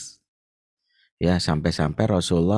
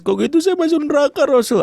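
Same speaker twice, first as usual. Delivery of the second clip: high, louder, half-crying alarmed plea; tearful plea; 5 dB louder than usual. Retold or imitated. Abu Bakar pleading with the Prophet, crying in alarm. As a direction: wail it like a frightened man near tears. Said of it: "Maka buru-buru dia nangis".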